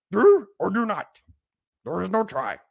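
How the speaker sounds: a quick, half-hearted try at Yoda; bad Yoda impression. Sports podcaster jokingly quoting Yoda on the Cowboys, weak Yoda voice. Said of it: "That was my best Yoda. That was terrible".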